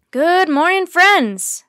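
loud, high, booming hello to friends; hearty greeting. A narrator's reading. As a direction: greet the whole camp at full voice. Teddy's hearty greeting at camp.

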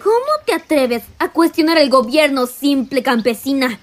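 rebuke, then drag out the command with force; angry rebuke. Acted. Commander putting a defiant peasant in her place, angry rebuke.

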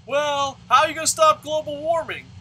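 loud, high sarcastic imitation; mock question and answer. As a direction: put on an overeager voice for a silly solution. Acts out question and absurd answer.

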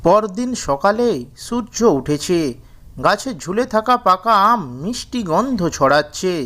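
usual speaking voice

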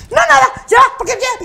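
high, loud defensive backpedalling; mock panicked brush-off; flustered denial. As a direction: imitate someone cornered. Actress's flustered denial, acted.

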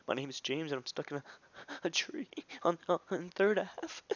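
high mock whimper breaking into airy squeaks; imitated crying. Crying kid telling dispatcher he's stuck in a tree, mock sobbing. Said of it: "he starts crying".

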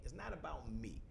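ordinary delivery

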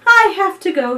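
high, loud farewell; announcing departure. A narrator's reading. Dugong leaving the children, high and loud. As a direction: call out that you must leave. Dugong says goodbye at sunset.

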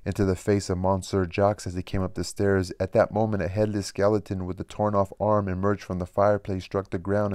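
plain reading voice

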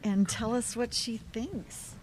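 usual speaking voice

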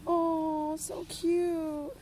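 high adoring coo. Real, not acted. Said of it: "this annoying cooing voice".

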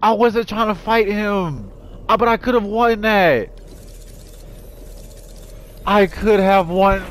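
high, drawn-out wail of regret; high-pitched sore-loser lament; anguished whining. Real, not acted. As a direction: whine it out after a crushing loss. Just lost the final fight.

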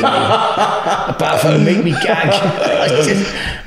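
words squeezed out through laughter, slow; cracking up mid-sentence at the memory; laughing. Real, not acted.

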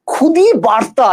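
খুদি বার্তা drawn out slowly; teacher slowly reads out খুদি বার্তা for the class; slow term read-out. Shown as a demonstration. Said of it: "এটা কি জানো?".

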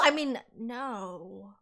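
low, soft, drawn-out correction; slow right down and play it cool; backtracking, feigned calm. Acted. Covers up his alarmed outburst.

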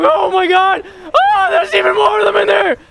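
very high, loud shriek; shrieked words ending in a wordless scream; panicked screaming. Real, not acted. Finds more zombies, screams.

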